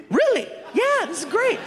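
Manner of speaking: high squealing excitement; giddy high-pitched delight; excited disbelief. Retold or imitated. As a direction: squeal it like great news. Gabe's overjoyed reply.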